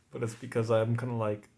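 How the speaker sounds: ordinary talk, nothing marked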